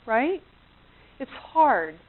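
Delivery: normal talking voice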